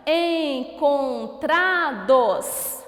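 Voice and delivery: ENCONTRADOS slow, each syllable drawn out; slow word presentation. Shown as a demonstration. Teacher introduces a word, ENCONTRADOS slow and measured. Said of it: "mais uma palavrinha".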